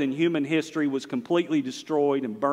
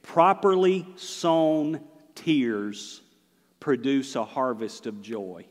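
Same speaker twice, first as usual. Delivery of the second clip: slow emphatic teaching; slower than usual. Shown as a demonstration. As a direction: spell it out slowly for them. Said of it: "understand, hear me".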